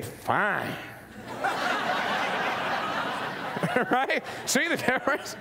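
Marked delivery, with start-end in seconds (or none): 0.0-0.7 low cold fine. Shown as a demonstration. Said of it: "Or you could say". 1.2-3.8 light airy laugh after the joke; breathy high giggle. Real, not acted. Speaker laughing at his own fine demo, airy giggle.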